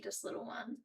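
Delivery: soft whispered coo; barely voiced, hushed for the pet. Real, not acted. Cooing at a shy pet.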